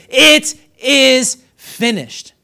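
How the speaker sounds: slow, high, loud proclamation; screamed cry. Retold or imitated. Preacher has listeners hear Jesus' last words, screamed slowly. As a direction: shout it out, each word held long. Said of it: "So listen to Jesus again".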